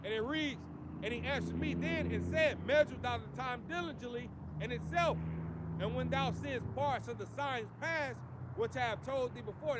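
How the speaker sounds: loud preacher reading scripture. Acted. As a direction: proclaim the scripture to the street crowd. Reads scripture aloud in sermon.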